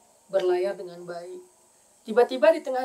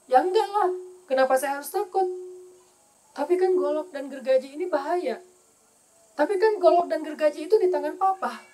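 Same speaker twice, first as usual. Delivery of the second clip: confident wife; 5 semitones higher, 4 dB louder than usual. Retold or imitated.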